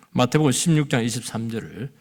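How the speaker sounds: normal talking voice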